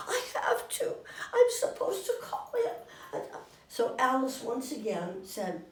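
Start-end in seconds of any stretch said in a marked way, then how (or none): 0.1-2.7 high, pleading insistence; insistent panic. Retold or imitated. Marilyn insisting to the narrator she must call, high pleading. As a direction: plead it like a frightened girl.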